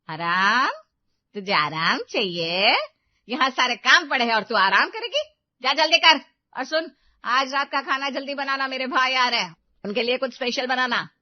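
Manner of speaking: loud mocking outrage at the word rest; mother-in-law scorns Rina's plea for rest, very loud; scornful shouting. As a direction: tear into her for daring to ask. Mother-in-law mocks request for rest.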